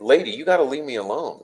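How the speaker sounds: ordinary talk, nothing marked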